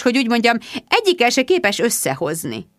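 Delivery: ordinary delivery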